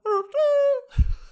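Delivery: very high, loud trilled squeal; imitated catchphrase squeal. Host shows [name]'s hook, imitated squeal.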